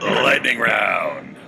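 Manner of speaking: slow, drawn-out game show call; announcer shout. Acted. Host announcing the lightning round, slow booming shout. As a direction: boom it out like a host.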